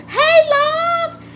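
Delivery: high, loud, thrilled hello; excited greeting. Real, not acted. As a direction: call out to a friend with joy.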